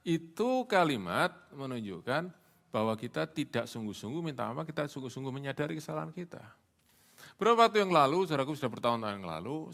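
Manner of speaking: normal talking voice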